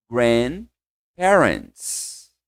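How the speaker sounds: Grandparents, very slowly, D almost silent; slow model word. Shown as a demonstration. Teacher models grandparents slowly for students. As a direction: drag out Grandparents. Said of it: "comigo de novo".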